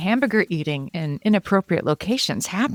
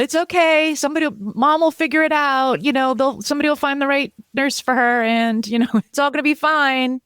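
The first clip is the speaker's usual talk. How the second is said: much higher, sing-song breezy it's okay; mock reassurance; 8 semitones higher than usual. Mocking people banging the drum, chirpy dismissive reassurance. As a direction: brush the worry off with chirpy cheer. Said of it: "It's like".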